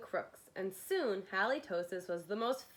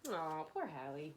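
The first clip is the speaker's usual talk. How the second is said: sympathetic aside; 5 semitones lower, 5 dB quieter than usual. Acted. Pitying aside.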